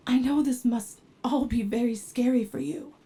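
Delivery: ordinary talk, nothing marked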